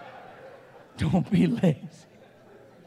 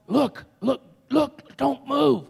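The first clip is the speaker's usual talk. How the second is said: higher, halting alarm to a partner; urgent warning; 5 semitones higher, slower than usual. Acted. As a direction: freeze him with a hushed-up, urgent warning. Warns partner amid rats.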